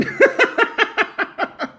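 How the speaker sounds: high real snort of laughter. Real, not acted. Streamer snorting at a caller's line, high laugh. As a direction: burst out laughing.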